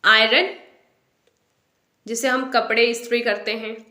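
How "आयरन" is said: आयरन as AI-run, r sounded, rising; common mispronunciation. Shown as a demonstration. Teacher posing the usual answer, आयरन as AI-run. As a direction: ask आयरन with a clear r. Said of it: "No, इसे हम बोलेंगे".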